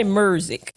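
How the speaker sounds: "music" said Memphis-style as MUR-zik; "music" with a Memphis "ur" vowel; Memphis pronunciation. Retold or imitated. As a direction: put an R in "music": murzik. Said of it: "say it like you from Memphis".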